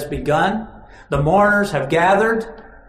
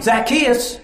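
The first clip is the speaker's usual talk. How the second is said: calling up; 5 semitones higher than usual. Acted. Jesus calling up into tree.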